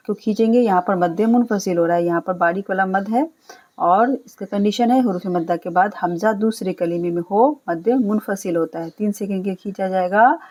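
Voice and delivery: usual speaking voice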